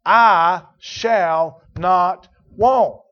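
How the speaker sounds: "I shall not want" drawn out very slow and loud, "I" punched; preacher hammering home each word; emphatic statement. Shown as a demonstration. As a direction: declare it word by word, rising in force. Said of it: "what he has just emphatically stated".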